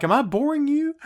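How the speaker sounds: hammed-up offended question; mock indignant. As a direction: squeak it out, offended. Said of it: "It's like".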